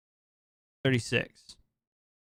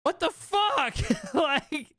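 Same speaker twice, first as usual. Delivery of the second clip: much higher, half-laughing disbelief; shocked outcry; 13 semitones higher, slower than usual. Retold or imitated. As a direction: squeal it in horror, breaking into a laugh. Retold horror at seance news.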